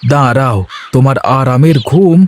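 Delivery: a cheerful farmhand talking to himself under the sun; young farmer Ganesh. Acted.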